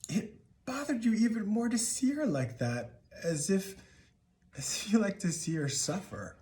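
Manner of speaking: high, pained voice, held back; wounded, high-pitched disbelief; pained reproach. Acted. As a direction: let the hurt lift your voice. Hurt recalling mother's suffering to father.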